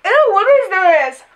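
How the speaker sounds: grossed out, loud and disgusted. Real, not acted. Disgusted at candy.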